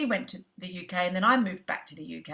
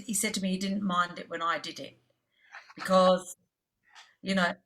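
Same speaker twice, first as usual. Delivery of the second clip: ordinary talk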